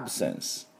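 "absence" said softly with an open ae on the first vowel; quiet demo of "absence"; model pronunciation. Shown as a demonstration. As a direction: ae then sence, ending on a soft s. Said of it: "こういう音になります".

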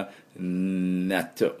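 long soft "んー" leading into "納豆"; cling to the n of "納豆" like sticky beans; drawn-out n before "納豆". Shown as a demonstration. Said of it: "粘る納豆をイメージしながら".

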